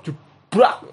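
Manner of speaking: crash noise. Retold or imitated. Crashing into sign.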